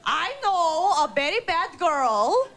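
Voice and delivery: put-on high, slow kid voice tattling to police; childish tattling. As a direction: play the tattletale child on the phone. Kid voice reporting mom to police.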